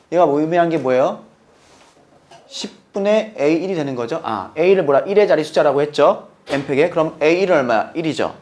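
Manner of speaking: ordinary delivery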